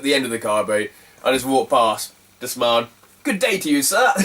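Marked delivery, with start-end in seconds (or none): 3.2-4.1 high, sing-out greeting breaking into laughter; cheeky mock politeness. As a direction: needle them with fake courtesy, about to crack up. Cheeky parting shot to seller.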